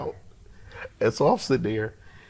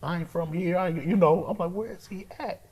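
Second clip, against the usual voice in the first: worried self-talk; 9 semitones higher, 4 dB quieter than usual. Retold or imitated. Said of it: "I said".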